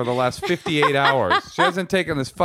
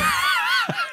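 high laugh. Real, not acted. Laughing at partner's critique.

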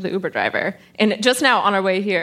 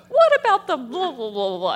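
starts high, then trails off into mock blather; lightly mocked driver rambling; Uber driver babbling; 3 semitones higher than usual. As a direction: brush it all aside as the cheery driver. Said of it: "And then the guy was like".

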